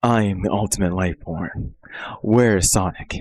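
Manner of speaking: a hint of a gruff edgy hedgehog; Shadow voice. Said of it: "I can try to sound like Shadow".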